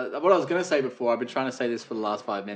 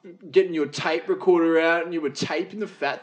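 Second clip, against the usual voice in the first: higher, louder, mock-accusing taunt; teasing accusation; 6 semitones higher, 7 dB louder than usual. Real, not acted. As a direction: needle your mate about his radio habit. Teasing friend about radio.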